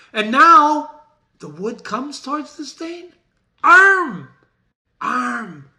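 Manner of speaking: high, loud call to arms; alarmed Macbeth. Acted. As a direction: rouse the castle in panic.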